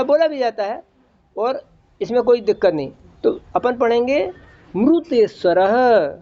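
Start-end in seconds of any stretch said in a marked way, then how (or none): 4.8-6.1 "मृतेश्वरः" as mru-teshvarah, drawn out; slow chant, ru sound opening "मृतेश्वरः"; southern mru reading. Shown as a demonstration. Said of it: "आप मृ बोलते हैं". ("मृतेश्वरः" में मृ को म्रु, धीरे)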